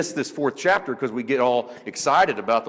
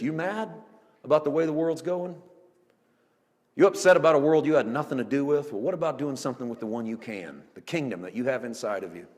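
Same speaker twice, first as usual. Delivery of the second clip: normal talking voice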